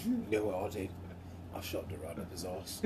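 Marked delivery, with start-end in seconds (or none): 1.6-2.8 low soft British boast; quiet gruff threat, lower; hushed action-hero brag; Statham impression. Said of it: "Sounds like Jason Statham".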